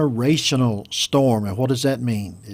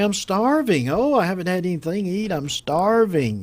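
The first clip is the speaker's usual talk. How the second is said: higher-pitched mock whine of hunger; complaining hungry diner; 5 semitones higher than usual. Customer complaining to server about the wait, hammed-up starving. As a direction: play up being famished, drawing out the last starving.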